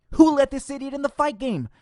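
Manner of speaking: high outraged yell; exasperated, shouting at the screen. Real, not acted. Outraged rant at pundit.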